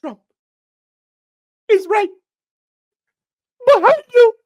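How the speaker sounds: high, loud terrified shriek; panicked shriek. Acted. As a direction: scream the warning in terror. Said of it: "will now reenact a full-on liberal panic".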